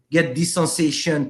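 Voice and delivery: normal talking voice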